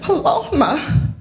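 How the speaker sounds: high, drawn-out name in mock tears; retold tearful cry. Wife in tears answers her worried husband, retold tearful cry. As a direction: imitate a tearful wail, slow and high. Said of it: "there are these tears just coming down my face".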